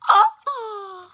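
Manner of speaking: high, loud "oh" of delight; excited squeal. Real, not acted. Reader showing viewers a signed book, delighted high squeal. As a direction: squeal over a treasured gift.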